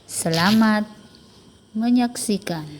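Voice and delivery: usual speaking voice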